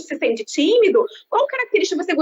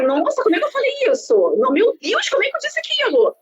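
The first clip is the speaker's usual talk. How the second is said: mock shocked self; 7 semitones higher than usual. Shocked on hearing own recording.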